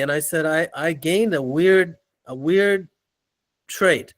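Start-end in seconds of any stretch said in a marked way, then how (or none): none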